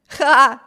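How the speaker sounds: one loud, high acted "ha"; burst of laughter. A narrator's reading. Brother laughs at Bruce's dangerous post, loud ha. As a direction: bark out a single mock laugh. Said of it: "Виберт расхохотался".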